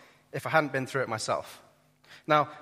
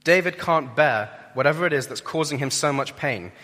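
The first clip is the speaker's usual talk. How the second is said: normal talking voice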